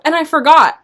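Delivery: ordinary talk, nothing marked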